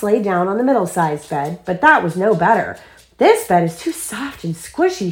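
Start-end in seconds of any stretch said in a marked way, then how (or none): 3.2-5.1 higher, lightly grumbled complaint; mildly fussy little girl; mumbled complaint. A narrator's reading. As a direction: complain with pitch raised a little. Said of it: "she mumbled".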